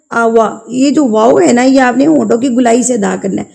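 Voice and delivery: ordinary talk, nothing marked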